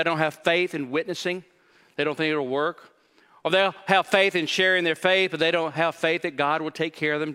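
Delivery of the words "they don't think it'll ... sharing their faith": ordinary delivery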